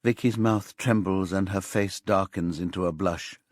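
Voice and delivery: plain reading voice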